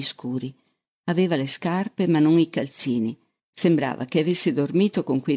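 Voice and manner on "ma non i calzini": usual speaking voice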